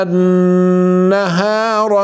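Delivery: slow, melodic tajweed recital; measured Quranic chant; Quran recitation. Acted. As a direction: chant the verses steadily.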